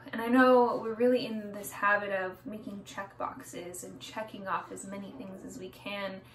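ordinary delivery

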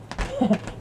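high, soft burst of giggles; short giggle. Pretend. Smoker amused by Gary, short high giggle. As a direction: let out a quick high giggle.

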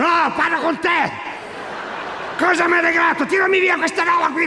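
high furious yelling; angry shout. Retold or imitated. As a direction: yell it, outraged and hot. Husband yells at wife, overheated. (urlo furioso)

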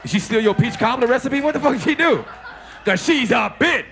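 loud, outraged roast of the heckler; shouting. Real, not acted. Comic yelling at a heckling woman, loud and outraged. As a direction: yell at her across the room.

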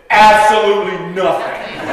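loud, laughing deadpan answer; loud laughing kid's reply; laughing punchline. Retold or imitated. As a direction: deliver the punchline through laughter. Punchline answer by Baptist kid.

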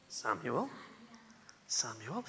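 low, soft, questioning call; hushed night-time call. Acted. God calling sleeping Samuel in the temple, hushed. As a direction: softly call a sleeper by name.

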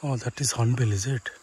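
normal talking voice

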